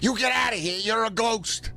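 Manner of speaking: high, panicked shopkeeper shooing a ghost; scared shopkeeper yelling at a ghost; frightened shopkeeper. Pretend. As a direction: shriek at the ghost to get out. Shopkeeper voiced in story.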